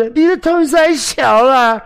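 put-on high, slow coy girlfriend voice; tender girlfriend voice. Shown as a demonstration. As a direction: coo it slowly and sweetly. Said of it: "她也可以用得很温柔".

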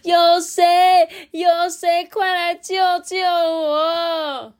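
high, loud cry for help, slowing at the end; calling for help. Acted. Ajun caught by the tongue, calling loudly for help. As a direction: shout for rescue while caught. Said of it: "阿俊就大声说".

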